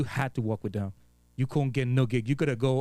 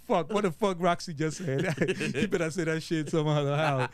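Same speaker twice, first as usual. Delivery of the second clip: put-on thick Virgin Islands accent, a bit higher; 3 semitones higher than usual. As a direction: slip into Virgin Islands talk, half joking. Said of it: "we'd be like".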